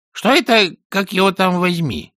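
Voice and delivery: high-pitched parrot voice, astonished; high, startled talking bird; amazed parrot. A narrator's reading. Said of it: "изумился попугай".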